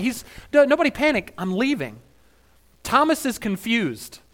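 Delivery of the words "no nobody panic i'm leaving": breezy mock reassurance. Mock Jesus calming disciples.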